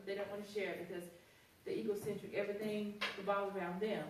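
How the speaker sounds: usual speaking voice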